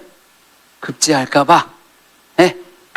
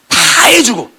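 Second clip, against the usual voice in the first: emphatic outburst; 14 semitones higher, 6 dB louder, slower than usual. Real, not acted. Emphatic exclamation.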